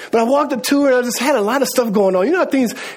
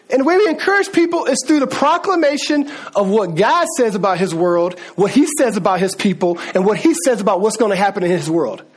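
ordinary delivery

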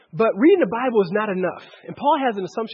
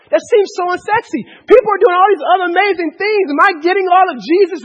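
loud, high-pitched sermon surge; impassioned preaching; 7 semitones higher, 7 dB louder than usual. Real, not acted. Preacher voicing doubts about faith to the congregation, impassioned. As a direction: preach it out at raised pitch and volume.

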